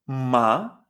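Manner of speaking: "ma" starting low and sweeping upward; second tone. Shown as a demonstration. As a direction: glide up through "ma" as if questioning. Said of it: "este segundo tono".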